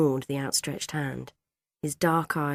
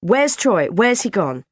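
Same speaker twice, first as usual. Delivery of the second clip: loud, raised worried questions; acted motherly alarm; frantic asking; 5 semitones higher, 8 dB louder than usual. A narrator's reading. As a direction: ask urgently, looking for a lost son. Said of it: "looking round wildly".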